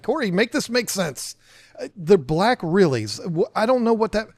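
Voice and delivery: high-pitched, baffled mock pleading; exasperated plea. Newbie begging Corey to make it make sense, exasperated. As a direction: act out begging for an explanation.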